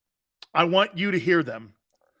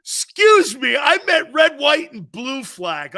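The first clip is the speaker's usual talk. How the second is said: hammed-up mock apology; 7 semitones higher, 7 dB louder than usual.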